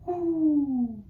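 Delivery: high, sung hoo like Mama Owl; high head voice. Shown as a demonstration. Teacher models Mama Owl's singing voice, high. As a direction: float it up high and clean. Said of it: "a beautiful singing voice".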